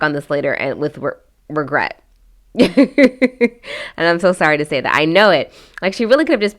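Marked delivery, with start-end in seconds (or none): 2.5-3.9 high burst of real laughter; laughing. Real, not acted. Vlogger amused by the bride's likely regret, laughing. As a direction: crack up mid-commentary.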